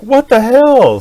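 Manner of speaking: high, loud what the hell; shocked outburst. Retold or imitated. Dad seeing the kid puke on the floor, loud shocked shout. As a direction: blurt it out in disbelief. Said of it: "I'm like".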